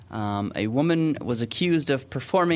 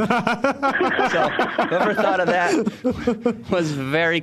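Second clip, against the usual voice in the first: talking through real laughter, high-pitched; helpless, slowed-down laughing delivery; 9 semitones higher, 3 dB louder, much slower than usual. Real, not acted. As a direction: struggle to get it out while laughing. Cracked up by photo caption.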